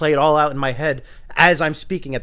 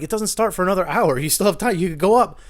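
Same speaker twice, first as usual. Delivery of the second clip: higher-pitched mock encouragement; retold urging; 6 semitones higher, 4 dB louder than usual. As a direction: coax a reluctant friend, pitched up. Urges the man to perform.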